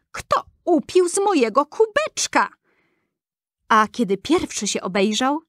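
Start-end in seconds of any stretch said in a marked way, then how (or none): 0.1-2.5 high, small dwarf voice asking who drank; a tiny dwarf, higher, puzzled; seventh dwarf asking. A narrator's reading. Little dwarf finds his cup touched, asks in a high voice. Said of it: "Siódmy".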